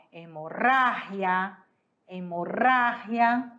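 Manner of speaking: "hemorragia" slow, trilled RR, throaty H sound on the G; slow Spanish "hemorragia", e-mo-RA-hia. Shown as a demonstration. Teacher models the Spanish word with a trilled R. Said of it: "Em espanhol".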